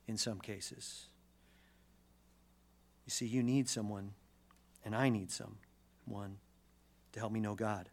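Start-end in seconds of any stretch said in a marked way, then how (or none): none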